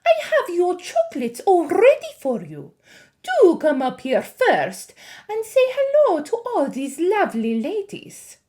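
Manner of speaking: high, loud, sickly-sweet witch; syrupy witch voice. A narrator's reading. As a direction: drip with syrup to lure the boy. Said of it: "soft and gentle and absolutely dripping with syrup".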